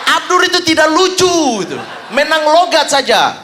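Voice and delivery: high-pitched yelled put-down; teriakan mencibir. Acted. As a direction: shout the badmouthing after him. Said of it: "tiba-tiba dia teriak". (berteriak menjelekkan dengan nada tinggi)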